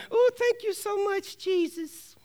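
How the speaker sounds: high, soft put-on gush; hammed-up thanks in a small high voice; mock grateful believer. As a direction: coo it sweetly in a high, mocking voice. Mock fair-weather believer thanking God.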